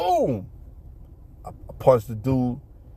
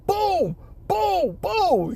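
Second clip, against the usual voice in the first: very high mock booms; punch sounds; much slower than usual. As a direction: slam out the punches. Imitating friend's punches.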